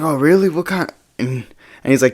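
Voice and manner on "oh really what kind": lightly voiced drunk guy, pitched up; drunk, high partygoer. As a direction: imitate an interested stoned guy, higher voice. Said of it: "He's, like, super drunk and high".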